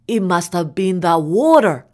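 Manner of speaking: ordinary delivery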